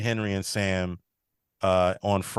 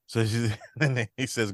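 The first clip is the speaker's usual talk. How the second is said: ordinary delivery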